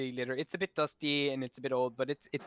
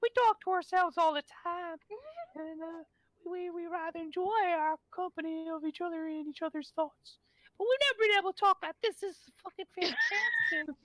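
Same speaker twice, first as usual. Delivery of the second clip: louder, faster, swearing with delight; higher, giddy mushroom crowd; excited spore colony; 3 dB louder than usual. Acted. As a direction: spores thrilled to finally talk. Spores speaking.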